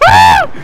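short, high, loud scream; startled scream. Real, not acted. Player startled mid-escape, short scream. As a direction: yelp out suddenly at a high pitch.

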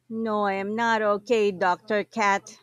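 plain reading voice

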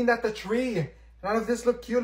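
normal talking voice

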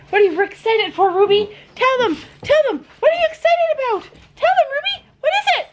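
high, rising sing-song to the dog; excited dog talk. Real, not acted. Talking up to Ruby the excited dog, squeaky and high.

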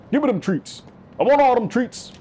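high, greedy little grabby voice. Pretend. Gamer grabbing trophies, high greedy voice. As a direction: whine it like a kid grabbing candy.

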